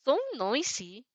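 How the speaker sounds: slow, soft, rude whine; childish whine. Acted. Annoyed shadow complaining at the noisy girl, whining. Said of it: "he whines, childishly and rude".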